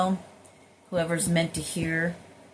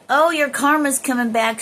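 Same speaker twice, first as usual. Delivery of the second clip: raised, louder quoted warning; mock warning; 8 semitones higher, 8 dB louder than usual. Others warning her about karma, voiced mock warning. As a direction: pitch it up like someone announcing payback.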